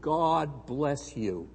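a slow, gentle blessing; an unhurried, tender benediction. Retold or imitated. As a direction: lay the blessing on him slowly. Famous preacher blesses the missionary.

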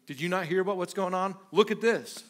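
ordinary delivery

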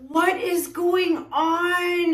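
high, slow wail of dismay; crying out at the ruined painting. Real, not acted. Artist to viewers at a failed pour, a high drawn-out wail.

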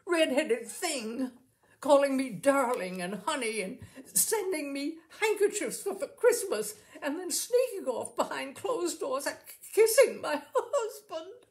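furious rant that cracks into a bitter laugh; desperate rage. A narrator's reading. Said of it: "she cried desperately".

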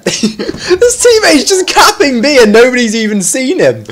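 high voice breaking with laughter; real laughing. Real, not acted. Gamer watching a teammate cap unnoticed, helpless laughing. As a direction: squeeze the words out through giggles. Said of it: "you know, I'm laughing".

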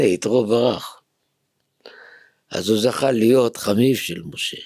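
ordinary talk, nothing marked

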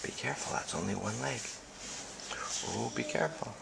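whispering. Real, not acted. Parent whispering to toddler on the furniture, hushed whisper. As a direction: keep it secret, whisper so nobody hears. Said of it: "Are we whispering?".

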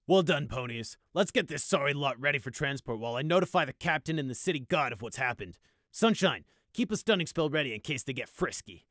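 a hint of brisk officer; commanding officer. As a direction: give orders to the troops. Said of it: "Bright ordered".

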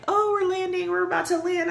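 high-pitched, giddy landing announcement; excited retelling. Retold or imitated. Traveler retelling the landing announcement on arrival in Rome, excited. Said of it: "I was so excited when they were like".

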